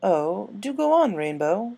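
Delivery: drawn-out gracious invitation; Rarity urging Rainbow to share her idea. Acted. Rarity voiced.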